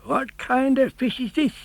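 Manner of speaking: high, amazed fisherman's voice; surprised cry. A narrator's reading. Fisherman startled by the strange fish, surprised cry. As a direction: gape at the odd catch and cry out. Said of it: "and he cried".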